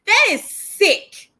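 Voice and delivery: high, slow, drawn-out outrage; outraged disbelief. Real, not acted. Reacting to fans bullying an idol, high slow outrage. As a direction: stretch it out in shocked disgust. Repeats, much higher and slower.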